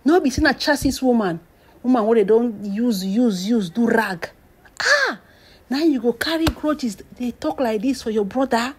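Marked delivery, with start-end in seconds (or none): 4.8-5.2 piercing high-pitched ah; shocked high exclamation; outraged high ah. Real, not acted. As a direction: cry out in disbelief. Outraged at the grudge.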